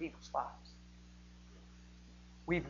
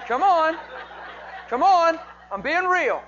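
shouted, higher, pressing hard; loud appeal; 12 semitones higher, 12 dB louder, slower than usual. Real, not acted. Preacher challenging the congregation, shouted and high. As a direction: call them out with a loud push.

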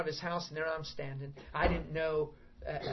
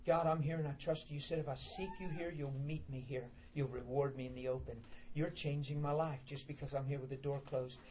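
softer, intimate surrender prayer; quiet prayer; 2 semitones lower, 6 dB quieter than usual. Acted. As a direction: pray it alone behind closed doors. Voices a private prayer.

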